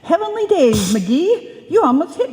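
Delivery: high startled cry at the near miss; startled exclamation. Acted. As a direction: pitch jumps high in shocked alarm. Nearly hit by paint spray.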